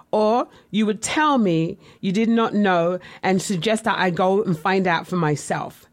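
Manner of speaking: normal talking voice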